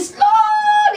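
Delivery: high, hugely stretched word; exaggerated talking-down slowness; mock slow talk. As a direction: draw SLOWLY out long and high. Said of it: "People talking to me slowly".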